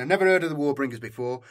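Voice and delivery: plain reading voice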